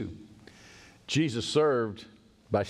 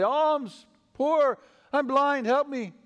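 beggar's cry; 14 semitones higher, 4 dB louder than usual. Acted. Blind beggars calling to travelers outside town, pleading cry. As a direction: call out for alms in long held notes.

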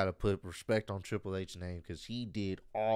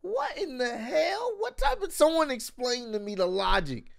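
high, incredulous outburst; can't believe what he's hearing; pitched way up in shock; outraged disbelief; 13 semitones higher than usual. Real, not acted. Host outraged at the news.

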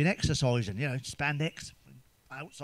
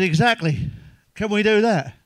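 loud, eager and keen; 5 semitones higher, 7 dB louder than usual. Retold or imitated. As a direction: push it out keenly. Eager reply to doctor.

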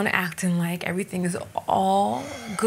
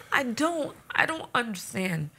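normal talking voice

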